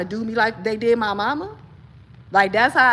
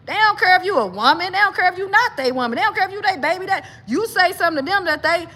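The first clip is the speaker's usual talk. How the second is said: heated, higher; 7 semitones higher than usual. Real, not acted. Heated self-defense rant.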